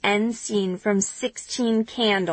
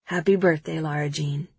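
tender; 6 semitones lower than usual. A narrator's reading. Peter says it at midnight.

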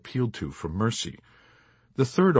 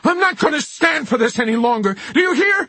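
much higher, louder furious shout; blazing fury; 8 dB louder than usual. Acted. Chaplain on his feet against the officers, furious shout. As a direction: roar it, fists clenched. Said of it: "in a blazing fury".

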